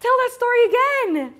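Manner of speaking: put-on loud, high kid's plea to mom; bambina insistente. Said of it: "I was like".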